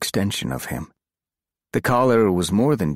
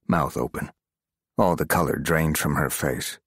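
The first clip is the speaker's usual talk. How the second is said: ordinary delivery, nothing marked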